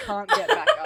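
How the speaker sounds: mock sympathy breaking into laughter; tease, then crack up at the end; laughing tease. Real, not acted. Co-host mocks a fallen friend, laughing tease.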